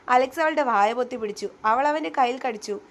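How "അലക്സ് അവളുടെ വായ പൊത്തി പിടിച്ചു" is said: usual speaking voice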